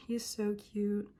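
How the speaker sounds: normal talking voice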